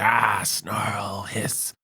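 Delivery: cartoonish beast grumble, trailing to a hiss; hammed-up grumpy creature; mock angry snarl. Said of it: "Why are you so angry?".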